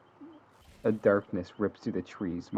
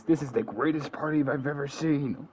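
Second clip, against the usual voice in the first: higher gleeful villain voice; Joker voice; 5 semitones higher than usual. Acted. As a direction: manic villain's last words. Joker's last line quoted.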